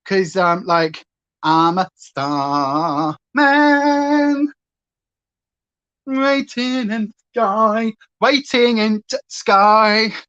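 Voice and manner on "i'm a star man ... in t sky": slow sung [name] in a light Yorkshire accent; Yorkshire Bowie. Shown as a demonstration. Vlogger singing [name] in a Yorkshire accent, slow. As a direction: sing Bowie, drop the the, Yorkshire style. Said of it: "[name] with Yorkshire accent".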